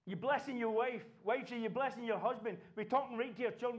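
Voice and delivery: usual speaking voice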